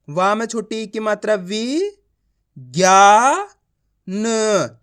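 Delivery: slow sounding-out with a long ज्ञा; letter-by-letter reading. Shown as a demonstration. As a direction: drill each sound, leaning hard on ज्ञा. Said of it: "एक एक अक्षर का उच्चारण कर रहा हूँ".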